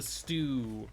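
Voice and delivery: STEW with a long, lingering "oo"; drawn-out U in STEW. Shown as a demonstration. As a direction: stretch the U in STEW. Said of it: "you like to extend the, the U on S Ts".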